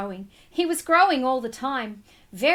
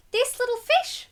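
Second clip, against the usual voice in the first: put-on child's voice; 10 semitones higher than usual. A narrator's reading. Child voiced in read-aloud story.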